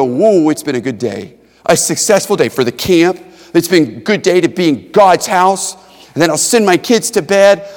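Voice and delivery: loud, high, building preaching; preaching at full force. Acted. Preacher describing a satisfied evening, loud fervent preaching. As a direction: lift it into joyful testimony. Preaching about his good day.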